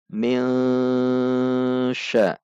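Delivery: "min" didengungkan samar dua ketuk menuju "sya"; ikhfa samar. Shown as a demonstration. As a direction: tahan dengung "min" dengan mulut bersiap di shin. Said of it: "namun dibaca". (n samar berdengung panjang sebelum "sya")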